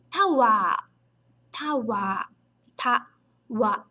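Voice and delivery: "tawa" dito devagar, sílaba a sílaba; "tawa" em pronúncia japonesa, com o a final alongado; japanese katakana reading. Shown as a demonstration. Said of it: "E o prolongamento". (pronúncia japonesa de "tawa")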